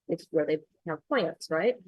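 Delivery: ordinary talk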